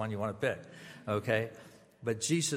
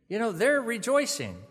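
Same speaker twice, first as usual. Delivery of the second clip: much higher and louder, mock-cheery reassurance; put-on cheerful insistence, pitched up; imitated upbeat consoler; 10 semitones higher, 6 dB louder than usual. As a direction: mimic a chirpy platitude to a mourner. Voices the platitude, then concedes it himself.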